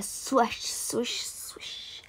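fading whispered swishes. Acted. Air swirls around after threatening fire, fading whisper. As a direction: let each swish die away into breath. Imitates moving air, dying away.

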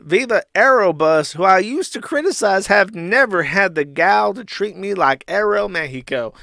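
high-pitched mock indignation; hammed-up complaint breaking into a laugh; Ethan Ralph. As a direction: play the wronged customer. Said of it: "To which Ralph replies and says".

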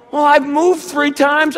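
high, loud, tearful wail; imitated crying daughter pleading her case; mock weeping. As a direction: whine it out through mock sobs. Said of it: "She's just weeping on the phone".

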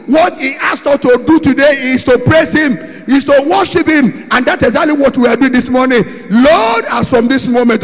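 relentless, fervent pulpit cry; full-force prayer. Real, not acted. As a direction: shout the prayer, driving through each repeated line.

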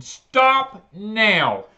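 loud, sharp "stop now" shouted into the phone; retold scream. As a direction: bark it to cut the caller off. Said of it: "I just screamed".

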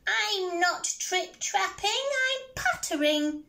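high, slow squeaky mouse voice; little mouse. Acted. Mouse answers the troll under the bridge, high squeak. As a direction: squeak each word out slowly.

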